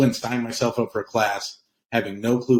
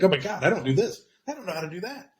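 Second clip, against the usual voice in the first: shocked realization; 10 semitones higher than usual. Retold or imitated. Retells own shocked realization.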